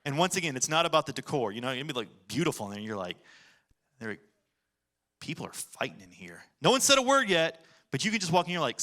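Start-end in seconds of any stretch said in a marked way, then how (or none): 4.0-6.4 hushed foreboding. Retold or imitated. Sensing strife in a room.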